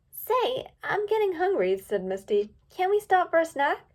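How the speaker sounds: high, whiny filly voice; Misty's voice. A narrator's reading.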